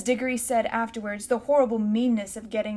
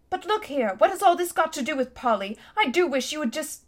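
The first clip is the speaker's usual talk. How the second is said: put-on higher-pitched, insistent boy breaking in; impatient protest; 5 semitones higher than usual. A narrator's reading. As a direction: press urgently for an answer. Boy impatiently demands news of Polly.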